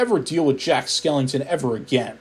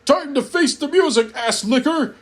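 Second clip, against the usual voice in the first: higher, taunting holler; 9 semitones higher than usual. Acted. Jack taunting the narrator before the meatballs, a light mock holler. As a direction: call out the threat with glee. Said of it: "Jack Skellington hollered".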